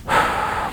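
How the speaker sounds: long unvoiced exhale; weary sigh. A narrator's reading. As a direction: let out a long breath before speaking. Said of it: "let out a long breath".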